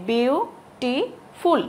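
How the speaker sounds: বিউটিফুল very slowly in three separate beats; broken into syllables. Shown as a demonstration. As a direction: break বিউটিফুল into syllables, pausing after each. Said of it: "ভেঙে ভেঙে উচ্চারণ করতে হচ্ছে আমাকে".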